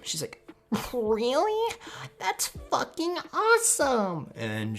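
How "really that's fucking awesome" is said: high, loud, drawn-out delight; mock high-pitched girl voice, gushing; excited fangirl squeal. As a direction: squeal it like a thrilled anime girl. Acting out excited yandere girl.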